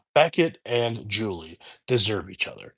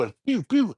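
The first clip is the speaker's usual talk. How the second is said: pew pew arrow sounds; 10 semitones higher than usual. Retold or imitated. Imitating arrow shots.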